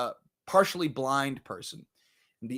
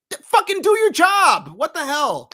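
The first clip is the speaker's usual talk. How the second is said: high, loud outburst of exasperation; exasperated yell; 10 semitones higher, 6 dB louder than usual. Acted. Reviewer berating useless social workers, high exasperated shout. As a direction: yell it in comic outrage.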